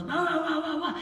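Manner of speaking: light barking wah wah; mock dog bark. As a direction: bark it out playfully, a mild mock bark. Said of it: "a dog goes".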